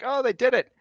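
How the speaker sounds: high, loud crowd cheer; delighted townsfolk acclaim; applauding crowd. Acted. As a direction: celebrate their success as an onlooker. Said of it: "Just kind of applause".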